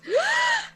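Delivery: big panicked gasp for air; high, acted breathless gasp; sharp gasp. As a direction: gasp as if you can't breathe. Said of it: "appears on the ground and goes".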